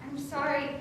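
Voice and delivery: ordinary talk, nothing marked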